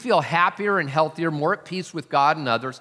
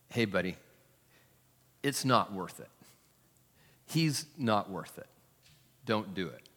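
much softer, calming plea; calming friend; 6 semitones lower, 7 dB quieter than usual. Retold or imitated. Hand on a shoulder, calming an angry man softly. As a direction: soothe him like a friend stopping a fight.

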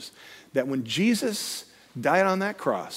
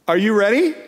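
rising, higher and louder question; 5 semitones higher, 6 dB louder than usual. Retold or imitated. Climber asks the belayer, questioning call. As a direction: call out "Are you ready?" lifting on "ready". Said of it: "it's actually a question".

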